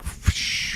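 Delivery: an unvoiced breath pushed out, almost a whistle; breathy phew. Dad at son's goodbye song, exhaling unvoiced. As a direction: blow out a shaky breath like holding back tears. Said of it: "and I was like".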